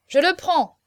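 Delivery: high, loud claim; eager cry. A narrator's reading. Apothecary snatching a lot at auction, eager cry. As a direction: shout to grab it first. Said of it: "s'écria un apothicaire".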